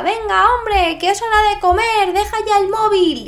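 put-on high, loud shout calling a teen to eat; mother yelling. Mother yells at teen on phone, loud and high. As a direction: yell it across the house like a fed-up mum. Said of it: "te llega tu madre y te grita".

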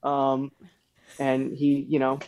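ordinary talk, nothing marked

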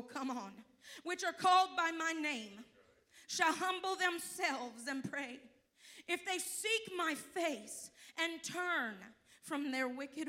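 slow, loud, proclaiming the verse to the church; preached scripture reading. Acted. Preacher reading Chronicles to the congregation, slow and booming. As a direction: call the scripture out like a preacher, word by word.

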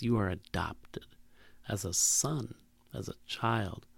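soft, low whisper, fading; whispered reassurance. Pretend. God telling the abandoned they're adopted, whispered tenderly. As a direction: whisper it like a blessing. Voicing God's tender reply.

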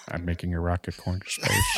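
low, soft awkward tech boss; Elon Musk. Acted. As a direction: murmur it with a suppressed laugh. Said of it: "Elon Musk and be like".

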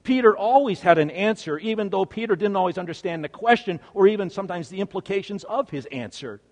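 normal talking voice

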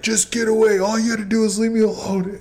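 high-pitched, frantic mock yelling; imitated meltdown. Acted meltdown of angry worker.

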